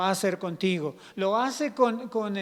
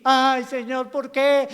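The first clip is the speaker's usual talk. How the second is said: loud, high mock lament; hammed-up tearful whining; complaining wail; 4 semitones higher, 8 dB louder, slower than usual. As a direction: wail at God in protest. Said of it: "llorando y quejándonos".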